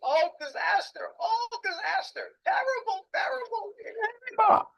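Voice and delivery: very high, slow mock wail of disaster through laughter; imitating a friend who laughs about his ruined business; laughing lament. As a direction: cry "terrible" while laughing it off. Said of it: "and he's laughing".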